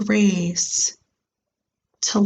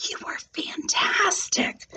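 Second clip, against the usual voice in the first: soft unvoiced whisper; 11 semitones higher, 5 dB quieter than usual. Classmate whispering praise to Grace after her audition. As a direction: breathe it to her, all air. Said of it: "whispered Natalie".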